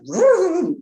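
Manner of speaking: a sudden high, loud vroom for the darting snake; whooshing vroom. Retold or imitated. Teacher telling students about the snake, a sudden loud high vroom. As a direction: shoot the word out like a snake zipping past. Snake darts across her lap.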